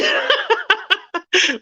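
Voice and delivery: high-pitched run of giggles; real high laugh. Real, not acted. Cracked up by the chat, laughing high. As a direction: laugh helplessly, high and quick.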